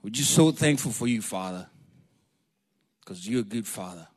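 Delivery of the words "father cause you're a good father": ordinary talk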